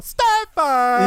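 high, stretched-out announcer cry; mock announcer hailing the scorer; imitated arena scream. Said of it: "Så man sitter och skriker".

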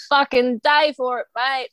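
a hint of an Australian accent; Aussie saying. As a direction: toss it off like an Aussie. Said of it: "I'm constantly going".

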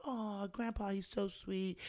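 high, fond mock gushing; gushing contestants. Contestants cooing over the older man, mock gushing. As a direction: coo over him like a sweet grandpa. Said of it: "everyone was like".

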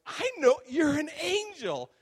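loud, high, drawn-out teasing yell; playful shouting. Retold or imitated. Dreamer taunting the angel to its face, playful high shout. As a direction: crow it gleefully at full volume. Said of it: "And so I'm playful".

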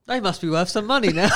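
said through a laugh; giggling while saying it. Real, not acted. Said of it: "The second one was a lot better".

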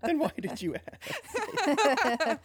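high, drawn-out question through laughter; laughing question. Real, not acted. Reacting to a friend's silly Marco Polo story, laughing. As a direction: ask it while cracking up.

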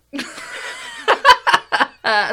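very high, loud peal of laughter; shrieking laugh. Real, not acted. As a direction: lose it laughing. Both cracking up.

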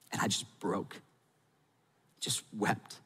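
barely audible, voice breaking; near-silent broken voice; choked up. Real, not acted. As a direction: let the words crumble as the tears come. Retelling breaking down.

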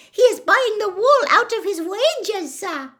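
very high, loud squeaky elf voice; happy elf. A narrator's reading. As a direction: squeak it proudly and happily as a little elf. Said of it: "the elf said happily".

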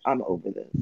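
low, soft retold resignation; fed-up resignation. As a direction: drop low and quiet, totally done. Retelling being fed up with shots.

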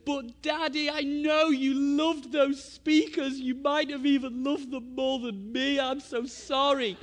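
high, loud imitation of a sobbing boy; mock wailing child. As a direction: wail like a heartbroken child pleading with dad. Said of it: "he's just sobbing".

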